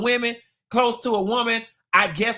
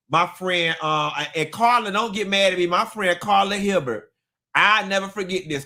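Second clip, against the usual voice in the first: ordinary talk, nothing marked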